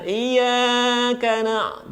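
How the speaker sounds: "Iyyaka na-" with "yaa" held too long; wrong, overstretched "Iyyaka na-"; overlong madd. Shown as a demonstration. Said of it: "jangan kepanjangan".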